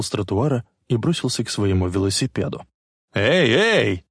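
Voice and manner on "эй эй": shouting. A narrator's reading. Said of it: "закричал водитель машины".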